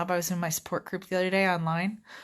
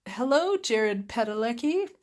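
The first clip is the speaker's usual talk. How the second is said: flirty admiration; 5 semitones higher than usual. Real, not acted. Admiring actor on TV screensaver.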